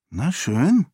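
"schön" lifted in a rising tune; airy, pretend-indifferent "Na schön"; feigned disinterest. A narrator's reading. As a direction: act as if you don't care, pitch rising at the end. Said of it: "stellte sich desinteressiert".

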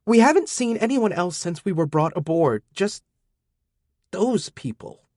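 nervous captive explaining; young woman voice. Acted. Elytra's voice held.

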